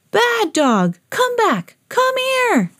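escalating high cries of a chase; calling after a dog. Acted. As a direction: call urgently after a runaway dog. Crowd chasing the dog.

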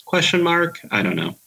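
usual speaking voice

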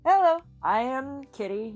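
usual speaking voice

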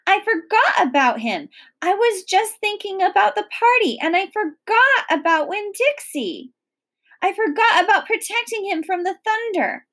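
high, hurried self-blame; distraught child's high, rushing outburst; panicked shouting. A narrator's reading. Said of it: "I shouted".

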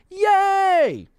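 squealed yay; high cheer. Real, not acted. Cheer after tease.

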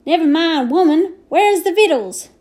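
hungry cowboy grumbling for food; grumpy Boone. A narrator's reading. Hungry Boone demands victuals, grumpy and loud. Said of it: "asks the ever-hungry Boone grumpily".

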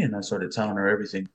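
normal talking voice

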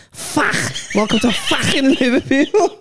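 slow, high, laughing mock Scouse announcer; sweary train announcement. Joking that the train swears on arrival, slow laughing mock announcement. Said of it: "It went".

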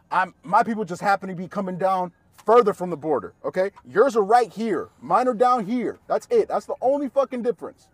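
high, loud, emphatic arguing; loud insistence. Retold or imitated. His loud argument in acted quarrel.

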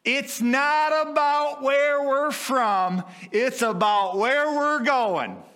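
high, loud, slow proclamation; bold declaration. Retold or imitated. Man answering the Arby's lady, loudly triumphant. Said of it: "looked at her and says".

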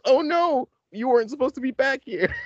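put-on high, startled girlish quote; mock shocked Joanna. Joanna caught packing by the judge, high mock gasp. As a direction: act out her being caught. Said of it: "and then she goes".

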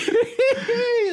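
shrieking burst of laughter; high helpless laugh. Real, not acted. Cohost cracking up at the Yorkshire read, high laughter. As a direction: crack up completely.

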